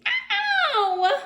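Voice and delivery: sudden high yelp of pain, ow; high scream. A narrator's reading. Girl screams ow out of nowhere in class. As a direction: shriek it like a hurt little girl. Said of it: "Leslie screamed".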